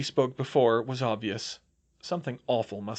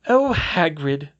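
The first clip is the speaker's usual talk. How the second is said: sighing; 5 semitones higher, 7 dB louder, slower than usual. Acted. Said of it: "she sighed".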